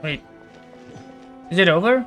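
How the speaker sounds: ordinary delivery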